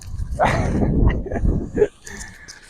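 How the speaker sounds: high-pitched breathless cackle at his own joke; high laugh. Real, not acted. Cracking up after telling the joke, high breathless laugh. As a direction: squeak out a laugh with no voice.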